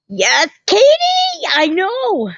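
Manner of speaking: high, slow sing-song call of her name; sing-song greeting. Real, not acted. Greeting viewer Katie in the live chat, a high drawn-out squeal. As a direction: stretch it out, way up high, like a happy squeal.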